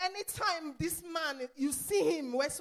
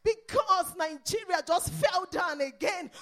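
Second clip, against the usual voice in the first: loud, driving sermon cry; full-force preaching; 2 semitones higher, 8 dB louder than usual. Acted. Preacher rousing the congregation about inflation, loud preaching. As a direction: preach it out to the back row.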